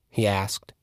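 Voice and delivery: ordinary delivery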